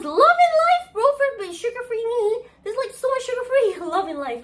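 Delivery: very high, loud squeal of joy; sugar-free joy, squealed high; ecstatic squeal. Real, not acted. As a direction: gush with delight. Delighted gush about sugar-free food.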